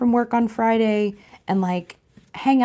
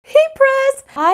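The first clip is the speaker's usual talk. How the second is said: excited; 7 dB louder, slower than usual. Real, not acted. Announcing her new purchase.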